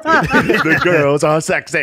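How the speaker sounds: high, slow, giddy; hammed-up excitement, laughing; mock excited. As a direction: drag it out like a thrilled fan. Sam voiced excited at show.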